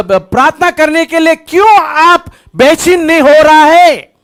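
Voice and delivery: urgent, emphatic preacher delivery; forceful preaching. Real, not acted. Preacher to congregation about praying mothers on a battlefield, forceful preaching.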